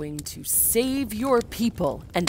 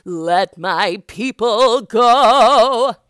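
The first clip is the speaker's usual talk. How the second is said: louder, slow, stretched proclamation; booming command; 4 semitones higher, 10 dB louder, slower than usual. Acted. Moses commanding Pharaoh, booming and slow. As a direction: demand it like a prophet.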